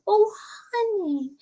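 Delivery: soft, high cooing comfort; tender sympathy. Retold or imitated. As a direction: coo it softly to a crying little sister. Sister comforts her sobbing sibling.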